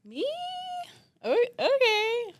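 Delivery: very high, loud, drawn-out mock surprise; hammed-up squeaky reaction; surprised squeak. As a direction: squeal it in exaggerated disbelief. Retold reaction to being praised.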